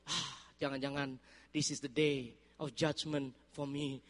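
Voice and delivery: quiet anxious inner voice; inner dread. Retold or imitated. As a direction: mutter it to yourself in dread. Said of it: "saya langsung ingat".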